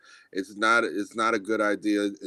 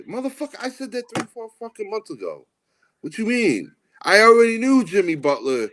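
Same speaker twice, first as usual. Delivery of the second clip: high-pitched exasperation without raising the volume; high, incredulous acted protest; mock indignant retort; 10 semitones higher, 4 dB louder than usual. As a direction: act out an indignant comeback, voice pitched way up. Acted indignant retort to idea stealers.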